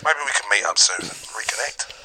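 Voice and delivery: hushed tender whisper. Acted. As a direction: whisper a heartfelt apology. Tender confession to son.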